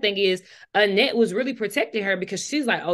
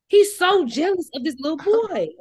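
high, loud, incredulous repeat; exaggerated disbelief; 9 semitones higher, 5 dB louder than usual. Real, not acted. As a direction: ham up the disbelief. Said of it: "I don't know why he's so jealous".

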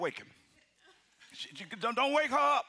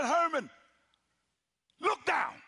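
urgent warning; 5 semitones higher, 3 dB louder, slower than usual. Retold or imitated. God sister urgently warning him.

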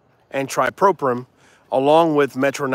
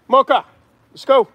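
high, loud call; calling the dog; 11 semitones higher, 6 dB louder than usual. Real, not acted. Breeder calling Mocha to come, loud and high.

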